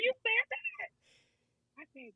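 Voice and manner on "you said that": high, squeaky shock; stunned teacher can't believe it; teacher stunned at her student's swearing, sharply surprised; shocked disbelief. Retold or imitated.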